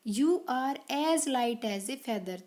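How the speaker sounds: very slow, careful phrasing; slow read-aloud. Shown as a demonstration. Teacher reading the answer sentence aloud, slowly. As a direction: lay out each word for young learners. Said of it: "last वाला है".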